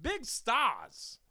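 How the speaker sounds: high, swooping Walken impression; hammed-up celebrity voice, proudly proclaiming; Christopher Walken. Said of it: "the owner looks like Christopher Walken".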